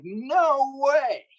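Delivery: high, pleased disbelief; hammed-up surprise; mock amazed. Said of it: "I'm like".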